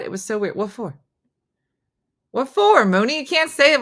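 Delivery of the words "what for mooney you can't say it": loud teasing. Real, not acted. Teasing, pressing a friend.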